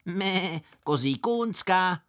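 high, slow goat bleat on the words. Acted. Schoolboy taunting the fruit seller, bleating like a goat. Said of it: "poskakoval a mečel".

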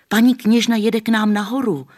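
high, loud excited shout; children shouting the news to grandma; calling out. A narrator's reading. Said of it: "volali všichni". (děti hlasitě volají na babičku)

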